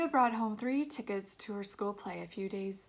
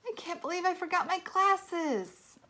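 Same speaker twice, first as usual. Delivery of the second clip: exasperated mom; 10 semitones higher, 8 dB louder, slower than usual. A narrator's reading.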